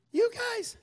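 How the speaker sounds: high-pitched, timid little answer; sheepish squeak. Acted. Traveler telling officer who misinformed him, sheepish and high.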